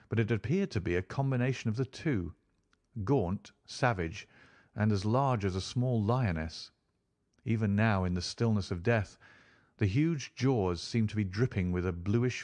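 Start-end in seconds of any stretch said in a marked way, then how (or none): none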